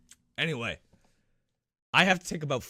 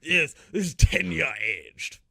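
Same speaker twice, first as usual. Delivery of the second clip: slow, drawn-out mock aristocrat; snooty rich boss; slower than usual. Boss showing off bourbon to employees, slow mock-posh voice. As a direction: savor it like a pompous lord. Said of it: "how Brian looks at his employees".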